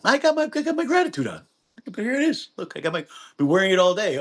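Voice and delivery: high, fast showing-off voice; mock boast. Mocking someone who wears gratitude, high fast boast. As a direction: parody of wearing gratitude.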